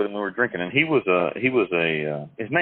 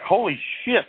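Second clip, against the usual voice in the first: high astonished exclamation; amazed; 12 semitones higher than usual. Retold or imitated. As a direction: blurt it out in shock. Pimp amazed at collected money.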